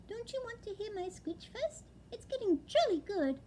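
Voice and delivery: high, small owlet voice; a young owl, wide-eyed and dazed; little owlet voice. Acted. Owlet telling his father, high and small. Plop's voice in the story.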